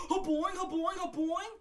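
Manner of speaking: high, loud springy boings; bouncy springy boingy voice. Retold or imitated. Imitating Mario jumping.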